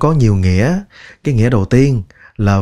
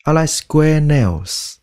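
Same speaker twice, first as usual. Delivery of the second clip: slow model sentence; 5 semitones higher, much slower than usual. Shown as a demonstration. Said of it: "slow model sentence".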